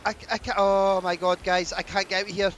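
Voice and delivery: stammering, high, loud panic; panicked. Real, not acted. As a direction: plead for help, trapped. Trapped, cannot get out.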